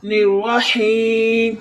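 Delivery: الرحیم with a long, held ee; lengthened ee. Shown as a demonstration. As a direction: hold the ee of الرحیم a moment, slowly. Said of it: "تھوڑی دیر ٹھہرتے ہیں". (الرحیم میں یا مدہ کھینچ کر)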